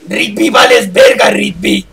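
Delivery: gym bro taunt, shouted; Muscle Man voice. Pretend. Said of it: "Esa sí me salió".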